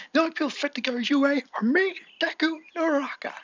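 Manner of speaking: high-pitched declaration to a crowd; acted proclamation. Bakugo telling who is fit to go his way, acted high proclamation. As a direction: declare it high, like a cartoon character.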